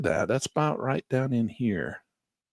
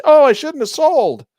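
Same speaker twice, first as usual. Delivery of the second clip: mock trader, loud and high, dreaming of the moon; regretful retail trader; 14 semitones higher, 10 dB louder than usual. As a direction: ham up a trader kicking himself. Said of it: "it gets all the retail traders to say".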